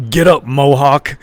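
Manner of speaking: big man's deep encouraging call, slow and loud. Retold or imitated. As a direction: boom it out like a huge linebacker. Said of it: "the big, big, deep voice".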